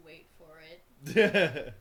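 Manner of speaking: slow and stretched, big build-up; drawn-out dramatic pause. Pretend. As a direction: hold it back, milk the suspense. Rocky Horror antici-pation bit.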